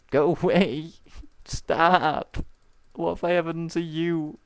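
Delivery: high, loud panicked pleading; panicked whining. Real, not acted. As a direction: plead with the zombies, laughing. Zombies attacking him.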